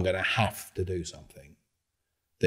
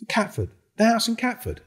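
higher pitched, mocking disbelief; retold exasperation; 4 dB louder than usual. Throwing the accuser's claim back, exasperated. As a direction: repeat it back like it's absurd. Heated retold pub confrontation.

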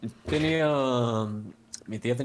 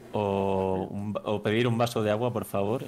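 usual speaking voice

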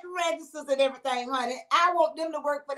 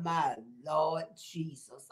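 slow, low, soft Lord Jesus; hushed lament; 10 semitones lower, 10 dB quieter, much slower than usual. Real, not acted. Host mourning the submarine crew, slow hushed lament. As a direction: sink into a sorrowful sigh. Lament over sub deaths.

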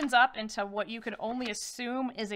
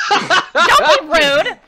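loud, very slow, laughing scold; hammed-up indignation through a laugh; mock offended; 8 semitones higher, 17 dB louder than usual. Crone scolds the bard for his bland wow, mock offended.

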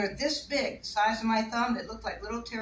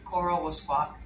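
ordinary talk

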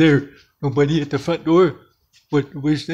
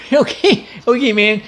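high, giggly words; laughing; 8 semitones higher, 3 dB louder than usual. Real, not acted. Storyteller cracks up as bedtime ends, laughing.

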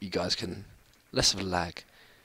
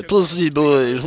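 lightly voiced mocking echo of "Pussy boy", a bit higher and louder; mocking the laughing soldiers; 4 semitones higher, 4 dB louder, slower than usual. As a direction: parrot the soldiers' taunt "Pussy boy" in a put-on voice. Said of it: "they're laughing at a pussy boy".

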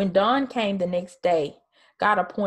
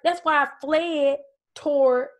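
higher pitched, indignant grumbling; exasperated Jonah; 7 semitones higher than usual. Retold or imitated. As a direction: complain about God's mercy like a sore loser. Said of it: "He's like".

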